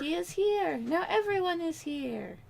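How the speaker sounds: high proclaiming voice. Pretend. Announcing cat grandly.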